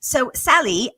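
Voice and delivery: attempted Australian accent that slips British; amateur Australian accent with a British twang; Aussie accent. Shown as a demonstration. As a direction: try an Aussie accent, pitched a little up. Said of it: "in an Aussie accent".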